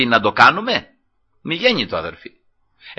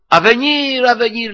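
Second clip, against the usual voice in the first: higher-pitched call to someone far off; retold hail, drawn out at usual volume; 6 semitones higher, slower than usual. David hails general Abner from the mountain, calling out. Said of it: "Άρχισε να φωνάζει το στρατηγό".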